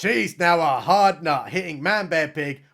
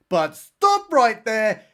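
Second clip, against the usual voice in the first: slow, slightly raised trailer-voice halt; dramatic announcer; 4 semitones higher, 4 dB louder, slower than usual. Pretend. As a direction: halt the story with mock drama. Dramatic narrator interruption repeated.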